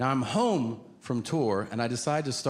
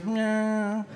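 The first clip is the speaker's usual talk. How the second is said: a higher, drawn-out cat meow; imitated cat meow; 9 semitones higher than usual. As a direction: meow like a cat, playfully odd. Senator meows again.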